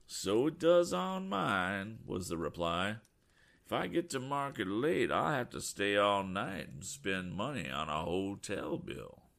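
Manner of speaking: put-on slow, stubborn rural farmer's accent. Farmer grumbling about his hotel bill, slow rural accent.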